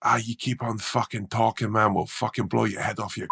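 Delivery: lightly voiced drunk Scottish heckler threatening the comic; a touch of a Scottish heckler's menace; Scottish heckler threat. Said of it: "this fucking guy turns around and went".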